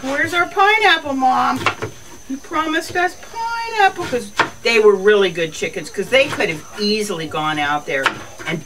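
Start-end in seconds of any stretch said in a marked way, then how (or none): 0.0-1.6 high pleading animal voice; begging for the promised pineapple; chickens' voice. Pretend. Voicing the chickens asking Mom for pineapple, high and pleading. 2.3-4.0 high pleading animal voice; begging for the promised pineapple; chickens' voice. Pretend. Voicing the chickens asking Mom for pineapple, high and pleading.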